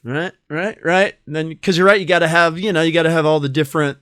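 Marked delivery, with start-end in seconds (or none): none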